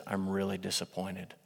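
quiet, low disappointed mother; mother's soft, heavy disappointment; disappointed mom. Retold or imitated. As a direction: hush it down like a let-down mom. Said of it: "when your mom looks at you and says".

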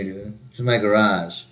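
plain reading voice

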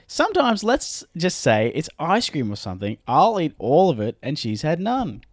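high indignant reading voice; silly reading voice. Acted. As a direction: read it like an aggrieved squeaky guy. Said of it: "Barry doesn't sound like that".

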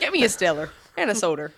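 Stellar with an r ending and soda as soder; imitating mom's soder accent. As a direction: imitate mom, r on the ends, quick. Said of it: "That's how my mom says".

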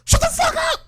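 very high-pitched screamed command; retold yell. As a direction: imitate her shrieked outburst mid-meeting. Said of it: "dead ass yelled".

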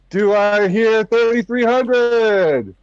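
calling out auction bids; auctioneer. Acted. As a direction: sell it like an auctioneer.

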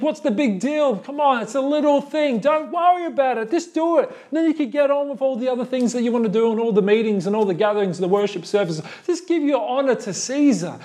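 high, coaxing, rushed; persuasive tempter. Retold or imitated. Imagined voice urging Christians to honor Caesar, high coaxing tone. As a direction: coax them to just do it.

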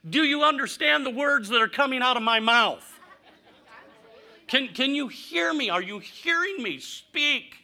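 loud, exasperated parent pleading to be heard. Retold or imitated. Parent to a silent teenager, exasperated and loud. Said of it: "It's frustrating".

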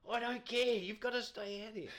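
high, loud call to stay out; excited umpire. Retold or imitated. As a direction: shout him back onto the field. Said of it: "I go".

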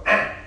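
put-on short whiny baby grunt; baby fuss noise. Dad imitating his baby daughter demanding food, a whiny grunt. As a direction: mimic a baby's fussy eh. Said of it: "which is her like".

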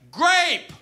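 put-on high, loud kid's shout of the flavor; excited kid. Eager kid shouting his snow cone flavor, excited.

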